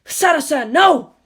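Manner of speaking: high, loud cry to stop her; desperate shout. Acted. Shinji shouting to stop Misato hitting his father, high and loud. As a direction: yell out to halt the blow.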